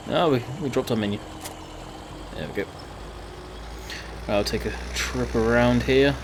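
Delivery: usual speaking voice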